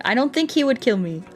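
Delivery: ordinary delivery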